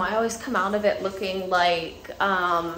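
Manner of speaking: usual speaking voice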